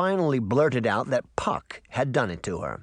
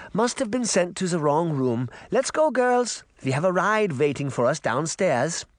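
put-on higher granny voice, light German accent; 7 semitones higher than usual. Granny herding the girls out, high German-accented voice. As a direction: hurry them along with a light German accent. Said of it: "in her light German accent".

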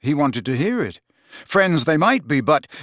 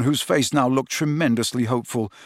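plain reading voice

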